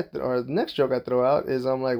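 ordinary delivery

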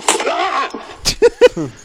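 high, slowed, cracking up; breaking up laughing. Real, not acted. Actor cracking up mid-sentence. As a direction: lose it into a laugh.

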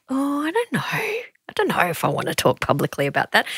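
hushed, worried whisper; nervous whisper. Retold or imitated. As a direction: whisper it like a scared girl. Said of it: "I was like".

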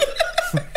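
airy squeaky laugh; high breathless giggle. Real, not acted. Laugh mid story.

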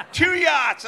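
high, loud outraged repeat; shouted disbelief. Retold or imitated. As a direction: shout it again, still stunned.

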